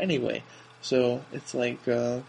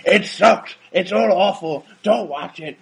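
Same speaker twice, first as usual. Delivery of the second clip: raised, louder griping; loud complaining; 6 semitones higher, 7 dB louder, slower than usual. Retold or imitated. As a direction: gripe loudly and high. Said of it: "'cause I'm like".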